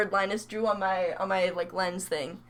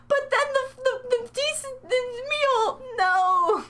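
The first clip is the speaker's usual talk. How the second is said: high-pitched tearful wail, hammed-up; mock crying; 13 semitones higher than usual. Streamer complains about a bad Wendy's order, mock crying. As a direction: whine it like a heartbroken fake sob.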